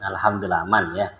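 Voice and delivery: ordinary talk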